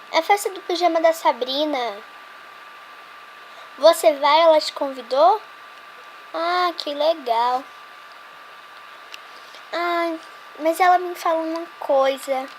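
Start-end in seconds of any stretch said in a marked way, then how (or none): none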